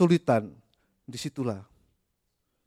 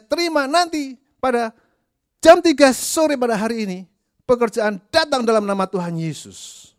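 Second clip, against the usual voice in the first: much higher, louder claiming prayer; mock pushy prayer; 10 semitones higher, 8 dB louder than usual. Preacher mocks a prayer claiming a job by three o'clock, high and loud. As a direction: pray like you can schedule God.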